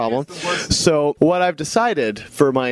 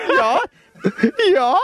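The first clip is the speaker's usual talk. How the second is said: put-on higher-pitched comic German accent; mock German; 10 semitones higher, slower than usual. Host demonstrating a show done in German, mock German accent. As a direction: put on silly German, laughing through it. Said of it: "doing the entire show in German".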